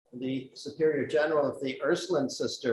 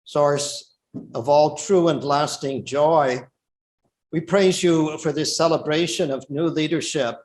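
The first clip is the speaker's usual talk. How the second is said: solemn, measured liturgical prayer; 5 dB louder than usual. Real, not acted. Presider leads opening prayer.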